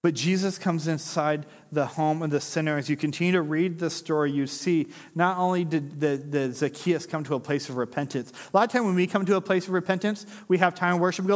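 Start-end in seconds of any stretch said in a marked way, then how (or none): none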